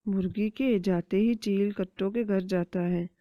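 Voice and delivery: ordinary delivery, nothing marked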